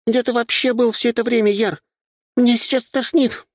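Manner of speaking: very high-pitched little sister complaining; Nyusha's voice. A narrator's reading. Little sister complains to Yar about feeling sick, high girlish voice. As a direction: scold in a squeaky high girl's voice. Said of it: "прерывистый голос Нюши".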